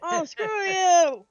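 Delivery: loud playful outburst. Real, not acted. Burned by sauce, teased.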